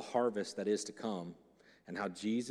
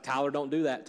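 firm warning, slightly raised; God warning Tyler; 5 semitones higher, 9 dB louder than usual. Retold or imitated. God warning Tyler, a firm raised caution. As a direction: caution him like a patient father. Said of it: "Sometimes he's like".

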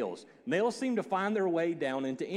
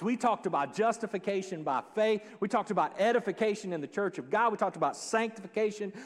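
normal talking voice

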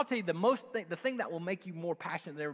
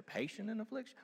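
affliction rising in disbelief; puzzled question; 2 semitones higher, 11 dB quieter than usual. Shown as a demonstration. Preacher doubting the verse, puzzled rising tone. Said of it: "something lost in translation there".

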